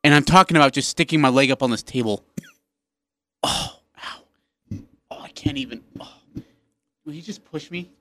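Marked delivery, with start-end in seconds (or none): 3.4-7.9 pained whisper. Acted. Foot hurts, stuck on table.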